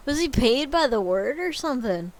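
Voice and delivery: ordinary delivery, nothing marked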